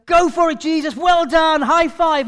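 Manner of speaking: high, loud mock praise; mock cheering. Disciples cheering Jesus on, hammed-up excitement. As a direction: cheer like a crowd punching the air. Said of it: "punching the air".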